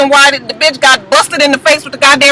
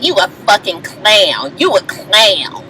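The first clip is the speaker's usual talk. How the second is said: slow, drawn-out put-down; acted slow disdain; contemptuous insult; 4 semitones lower, 4 dB quieter, slower than usual. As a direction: stretch each insult out, pitched low. Acting out Mimi's insult.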